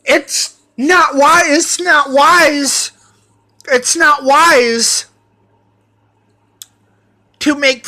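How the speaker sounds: high, loud, fast mock yelling at the door, slowing down at the end; shouting through the door. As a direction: bang on the door and yell his name over and over. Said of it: "She's like".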